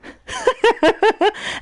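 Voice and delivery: high cackling Joker laugh; maniacal laugh. Retold or imitated. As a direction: let out a crazy clown cackle.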